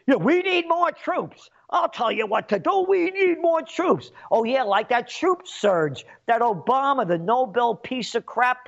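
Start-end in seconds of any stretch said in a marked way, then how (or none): 0.1-2.9 much higher, a sarcastic hawk's demand; mock hawk. Ranter mocks officials demanding more troops, higher and sarcastic. Mocking war hawks. 2.9-4.1 much higher and slow, dragging it out sarcastically; mock hawk. Ranter mocks officials demanding more troops, slow and higher. Mocking war hawks.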